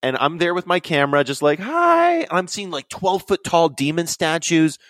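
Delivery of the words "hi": very high sheepish hi; awkward hi. Retold or imitated. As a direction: squeak a nervous hello. Awkward greeting at ashram.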